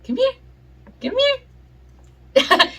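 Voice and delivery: high, sing-song calling; high dog-talk voice. Real, not acted. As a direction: call the puppy in sweetly.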